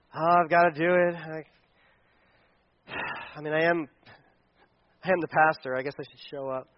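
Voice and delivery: soft, sighing, grudging voice; reluctant sigh. Retold or imitated. Pastor mocking his own reluctant worship, soft and sighing. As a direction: drag yourself there with a sigh.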